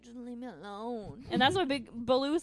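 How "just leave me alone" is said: mild, quiet sulk; sad Mowgli. Acted. Moping kid brushes off the bear, quiet sulk. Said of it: "like, sad in this one".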